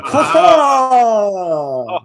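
big high triumphant cry; excited shout. Acted. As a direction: throw it out like a villain's discovery. Echoing the dramatic exclamation.

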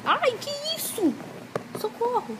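high, playful pretend scare; hammed-up little panic; mock fright. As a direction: squeal it like a pretend victim.

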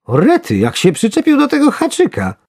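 high, loud amazement; astonished exclamation. A narrator's reading. Angler seeing worm cling to hook, amazed cry. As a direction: exclaim in excited disbelief. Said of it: "wykrzyknął".

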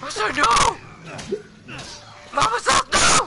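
high shouted scolding; shouting. Acted. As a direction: bellow it like a mother. Peak of chant.

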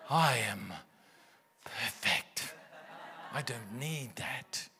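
mock-smug, low and drawn out; self-satisfied inner voice. Preacher mimicking a proud inner voice for laughs, mock-smug. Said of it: "That's the voice".

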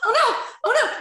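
high, loud, laughing cry of dismay; a laughing wail of despair; anguished oh no. Retold or imitated. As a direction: relive the horror of the miss, half laughing. Retelling watching the missed kick.

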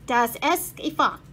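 put-on German words in a thick accent. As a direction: say it casually, like a native German. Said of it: "said a man with a thick German accent".

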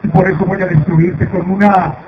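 loud gloating villain, building to a shout; mustache twirling villain. Acted. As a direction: ham up the evil threat. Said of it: "Canon es malo".

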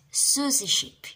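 ordinary delivery, nothing marked

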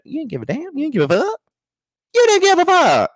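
high-pitched indignation. Real, not acted. Heated repetition scolding Jackie's indifference.

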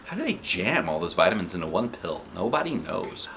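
ordinary delivery

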